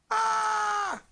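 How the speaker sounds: high, drawn-out "aah"; mock scream. Dad showing off bright light, high mock scream. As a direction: cry out as if dazzled.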